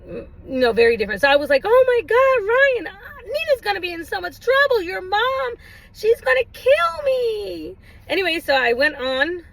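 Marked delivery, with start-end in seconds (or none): 1.6-7.8 much higher, slow, dramatic scolding; over-exaggerated dismay. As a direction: ham up the horror on purpose. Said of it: "I was over-exaggerating on purpose".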